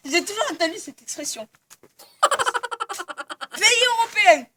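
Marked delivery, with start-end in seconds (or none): 2.2-4.5 laughing. Real, not acted. Interviewer laughing.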